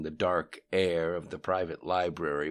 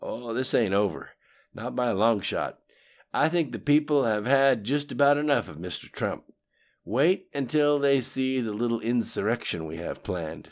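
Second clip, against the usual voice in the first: louder, higher boastful Bill; lightly voiced cocky politician; Bill's voice; 6 semitones higher, 8 dB louder than usual. A narrator's reading. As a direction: brag about old schemes, loud. Bill's defiant reply.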